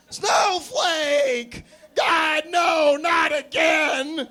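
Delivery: frantic high shout, slowing on not again; panicked scream. Acted. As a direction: scream in horror as the dog attacks. Owner sees puppy attack Bill.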